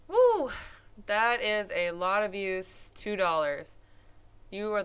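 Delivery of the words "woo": excited. Real, not acted. Views go up.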